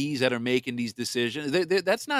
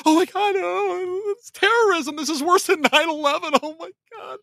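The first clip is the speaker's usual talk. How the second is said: high, hysterical mock panic; mocking a hysterical snowflake; whiny overgrown Nancy; 4 dB louder than usual. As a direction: shriek it like a pearl-clutching drama queen. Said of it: "big, overgrown freaking Nancy".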